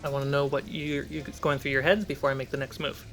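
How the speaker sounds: ordinary delivery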